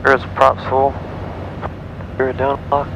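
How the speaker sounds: ordinary talk, nothing marked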